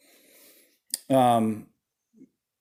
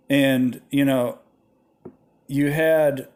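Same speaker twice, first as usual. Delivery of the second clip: ordinary delivery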